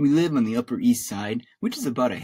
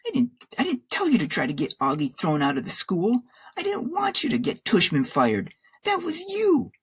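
high-pitched, indignant protest; angry accusation; 13 semitones higher than usual. A narrator's reading. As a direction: build to a high, pointed accusation. Boy blames his mother.

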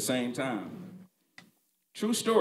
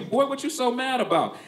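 dad asking; 8 semitones higher, 4 dB louder than usual. Retold or imitated. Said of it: "He was like".

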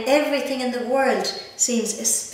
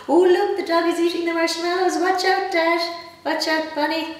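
higher, louder urgent call; excited calling out; 9 semitones higher, 6 dB louder than usual. Pretend. Reader warning Dad and Bunny about the dog, urgent higher call. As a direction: shout a warning at the greedy dog.

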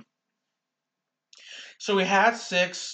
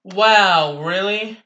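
sarcastic disbelief; 4 semitones higher, 9 dB louder, much slower than usual. Real, not acted. Teammate dies in one hit.

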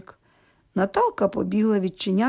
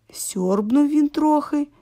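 ordinary delivery

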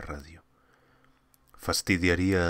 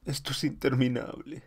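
frightened pleading; 8 semitones higher than usual. Acted. Astronaut panicking, adrift in space.

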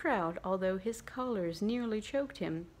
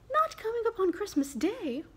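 much higher, louder disbelief; dismayed father; 12 semitones higher, 5 dB louder than usual. Acted. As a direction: cry out in disappointment. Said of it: "sudden declension in his high spirits".

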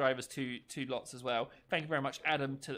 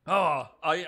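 ordinary talk

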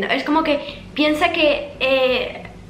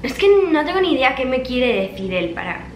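ordinary delivery

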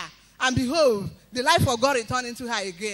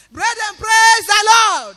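high, slow, loud shout of praise; 7 semitones higher, 14 dB louder, slower than usual. Real, not acted. Testifier calls the church to praise, slow loud shout.

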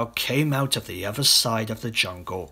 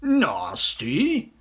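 higher, rising mock disbelief; indignant cry; 7 semitones higher, 4 dB louder than usual. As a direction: crocodile echoing the word in protest. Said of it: "cried the crocodile".

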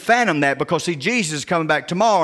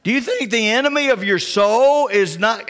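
ordinary delivery

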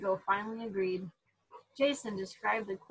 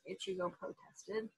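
ordinary delivery, nothing marked

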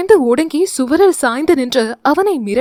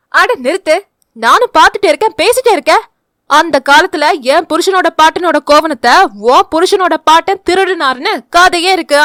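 put-on high, loud, fast old woman scolding; fierce retort; 4 semitones higher, 5 dB louder than usual. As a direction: shut them down loudly. Mother-in-law joins the quarrel. (சண்டையில் இறங்கும் மாமியார்)

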